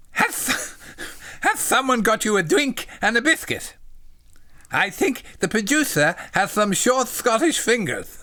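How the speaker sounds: high, simple-minded little voice; eager, high-pitched polite question; childlike John. Acted. Voicing John in the story.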